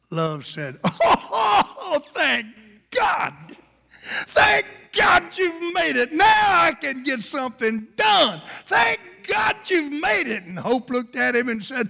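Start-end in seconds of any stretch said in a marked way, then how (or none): 0.8-10.4 high, shouted thanks with a laugh; excited relief. Acted. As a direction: cry out in overjoyed relief. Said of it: "Got Hope and Love so excited".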